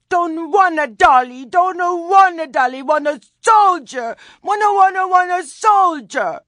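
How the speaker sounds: put-on very high, loud bawling child; screaming toddler tantrum. A narrator's reading. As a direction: wail it like a furious small boy. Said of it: "his screaming mouth".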